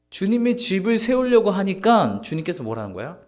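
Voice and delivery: usual speaking voice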